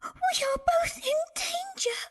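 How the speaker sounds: tiny, extremely high squeak; whimpering piglet squeak; frightened little pig. A narrator's reading. Pig warning brother of danger, frightened squeak.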